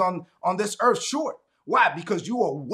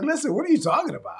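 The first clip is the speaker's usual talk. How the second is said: higher, fast brush-off; dismissive; 7 semitones higher, faster than usual. Retold or imitated. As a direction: laugh off the apology fast. Dismissive retort retold.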